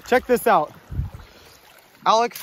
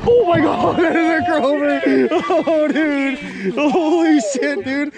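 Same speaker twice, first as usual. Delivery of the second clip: excited laughing shout; 6 semitones higher, 5 dB louder, slower than usual. Real, not acted. Landing a huge Chinook.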